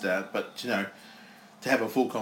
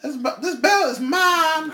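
very high, slow, loud claim; champion shout; 6 dB louder, slower than usual. Acted. Voicing the champion.

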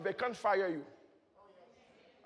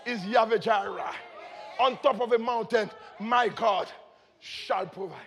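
loud, high declamation of scripture; full-force preaching; 4 semitones higher, 8 dB louder than usual. Real, not acted. Preacher declaiming psalms and names of God, fervent full-force preaching. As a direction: proclaim praise with mounting fervour.

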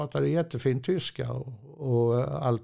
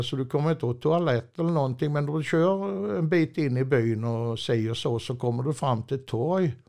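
ordinary talk

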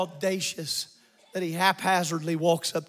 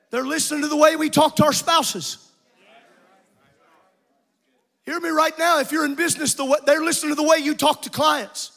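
raised, insistent pulpit voice; exhorting preacher; 7 semitones higher, 6 dB louder than usual. Acted. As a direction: press the warning on the congregation. Preaches at full force.

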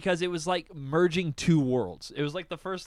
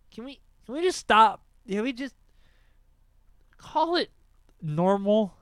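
high, loud, fast exasperated plea; pleading in disbelief; 6 semitones higher, 6 dB louder than usual. Real, not acted. Exasperated plea about names.

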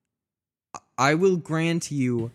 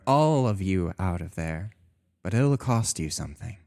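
low god voice; 8 semitones lower than usual. Acted. God voice repeats offer lower.

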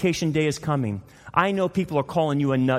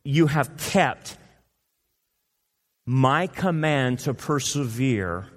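slow quoted verse; slower than usual. Shown as a demonstration.

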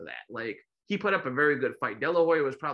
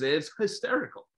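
ordinary talk

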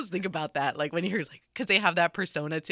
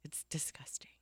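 disgusted whisper; 17 dB quieter than usual. Real, not acted. Host recoiling from the thought of olives, whispered. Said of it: "I hate olives".

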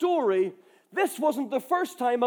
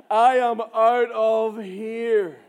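slow, drawn-out declaration; very deliberate, drawn-out punchline; emphatic exit line; 2 semitones lower, 4 dB louder, much slower than usual. Retold or imitated. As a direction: stretch every word for comic finality. Acts out his exit line.

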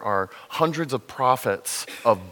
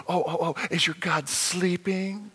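higher, sarcastic taunting; sarcastic mock concern, pitched up; 6 semitones higher than usual. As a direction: needle them about their sleeping god. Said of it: "mocking the prophets of Baal".

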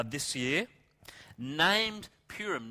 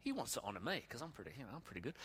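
quiet, quick, self-satisfied aside; inner thought; 3 semitones lower, 14 dB quieter, much faster than usual. Acted. Haman thinking he is to be honored, a hushed smug aside. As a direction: think it to yourself, much softer. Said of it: "Haman's thinking".

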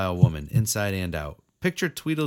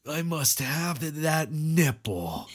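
creepy mock voice; 5 semitones higher, slower than usual.

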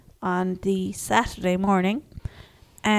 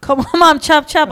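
put-on louder, higher echo of the bossy boy; mock bossy kid; 5 semitones higher, 8 dB louder than usual. Mum echoing her son's order, louder and mocking. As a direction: throw the line back, hammed up. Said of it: "mimicking the son".

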